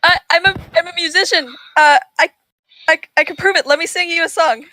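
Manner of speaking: flustered acted self-defence; nervous stammering plea. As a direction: stammer an eager excuse at bowpoint. Bard pleads with shouting ranger.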